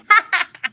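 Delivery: high open-mouthed cackle; witch cackle. Pretend. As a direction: throw your head back and cackle. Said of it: "I open my mouth, like, so big".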